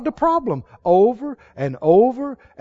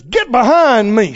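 Jesus rebuking; 5 dB louder than usual. Retold or imitated. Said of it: "when He wheels around and says".

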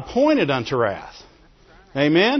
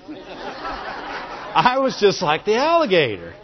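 laughing; slower than usual. Real, not acted. Preacher laughing through his point.

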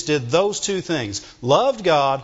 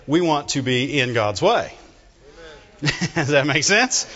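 normal talking voice

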